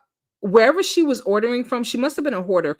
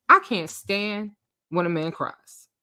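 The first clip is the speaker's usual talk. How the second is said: ordinary talk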